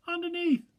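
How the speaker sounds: a muffled small voice from under a bear; underneath sort of way. Acted. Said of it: "in an underneath sort of way".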